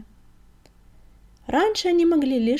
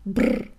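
shivery lip-trill "brrr"; snorted brrr. A narrator's reading. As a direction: shake off the cold with a brrr. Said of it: "фыркнул Крутобок".

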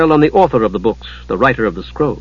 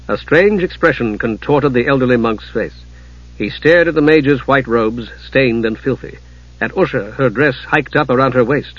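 plain reading voice

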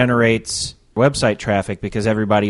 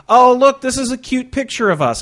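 put-on high, loud, gushing girlish delight; mock gushing wife; 14 semitones higher, 6 dB louder than usual. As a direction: squeal it like a thrilled wife. Imitating wife Laura's delight.

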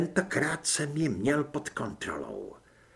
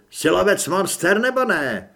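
higher, pushing taunt, ending on a high question; challenging taunt; 4 semitones higher, 6 dB louder than usual. Acted. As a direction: goad him into answering. Duch vyzývá. (vyzývavý posměch)

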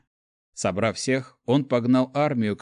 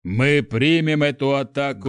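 shouting; 4 semitones higher, 4 dB louder than usual. A narrator's reading. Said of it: "крикнул герцог Астрель".

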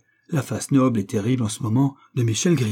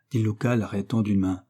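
plain reading voice

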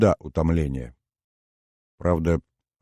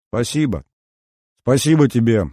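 bright, bell-like thanks, louder at the end; ringing like a bell; 6 semitones higher, 6 dB louder than usual. Acted. Inna calls thanks from the hallway, bell-like. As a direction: call it out like a little crystal bell. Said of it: "Хрустальным колокольчиком".

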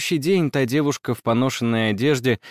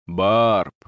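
low, distinct call of a name; deep voice calling out of the dark; a mysterious low summons; voice in the night; 7 semitones lower than usual. A narrator's reading. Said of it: "отчётливо услышала я посреди ночи".

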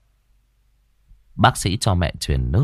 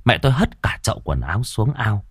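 ordinary delivery

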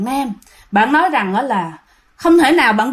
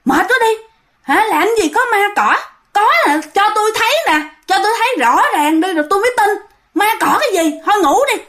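higher, brash scoffing; bold talk; 8 semitones higher, faster than usual. Retold or imitated. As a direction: boast that you fear nothing. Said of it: "Mạnh miệng các bạn".

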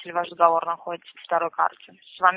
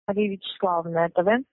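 ordinary delivery

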